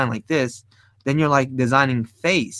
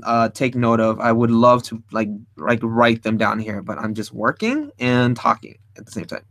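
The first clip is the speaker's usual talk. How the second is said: normal talking voice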